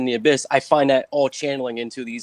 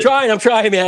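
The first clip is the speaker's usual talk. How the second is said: loud laughing protest; 9 semitones higher, 10 dB louder than usual. Real, not acted. Laughing, protesting over teasing.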